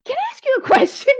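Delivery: high, quick giggly ask; laughing question. Retold or imitated. Asking the stranger something odd, high and laughing.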